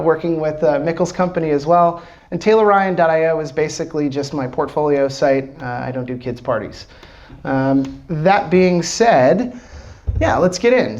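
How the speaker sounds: usual speaking voice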